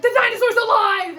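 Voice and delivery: high, loud panicked yell; panicked shout. Retold or imitated. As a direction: shout it in terror. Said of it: "Larry's like".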